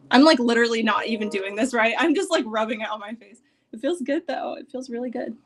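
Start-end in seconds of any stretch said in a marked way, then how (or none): none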